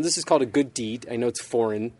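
normal talking voice

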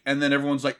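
usual speaking voice

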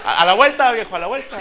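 high, dismissive, laughing "a la vuelta"; mock brush-off. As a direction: wave him off with a laugh. Ellas lo despachan. (rechazo burlón y risueño, agudo)

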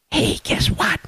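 hushed mock whisper. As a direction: whisper it like a secret. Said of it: "Joe, why are you whispering?".

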